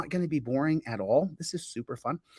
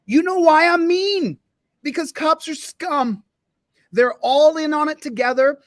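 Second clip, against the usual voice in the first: high, slow, loud outburst; loud rant; 9 semitones higher, 6 dB louder than usual. Real, not acted. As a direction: rail against them. Ranting about cops.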